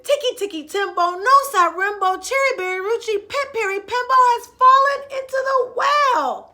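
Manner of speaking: high, loud urgent calling; frantic shout. Acted. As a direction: yell it out desperately. Chang shouting name again.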